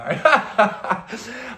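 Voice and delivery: short burst of real laughter; laughing at how dumb it got. Real, not acted. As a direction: crack up mid-story. Laughs at his own story.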